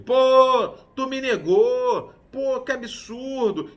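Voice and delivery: loud, high, slow rebuke; mock reproach. What Jesus did not say to Pedro, a loud high rebuke. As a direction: throw it in his face with outrage. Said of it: "Jesus não joga na cara do Pedro".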